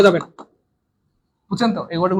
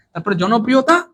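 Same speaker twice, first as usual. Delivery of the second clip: usual speaking voice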